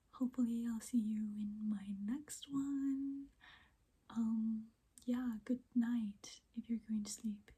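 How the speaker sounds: soft whispered ASMR. Real, not acted. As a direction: whisper close to the mic.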